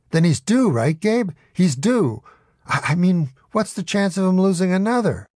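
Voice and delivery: lightly voiced gambler's hope; excited. A narrator's reading. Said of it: "this gets Adam Eget excited".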